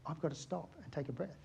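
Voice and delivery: ordinary talk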